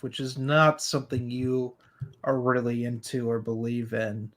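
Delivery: usual speaking voice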